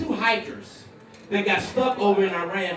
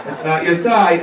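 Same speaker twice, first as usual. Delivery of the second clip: loud, lower mock-wife order; instructing wife; 4 semitones lower, 6 dB louder, slower than usual. Wife sending her husband to bed, loud instructing voice. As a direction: announce it firmly as the wife.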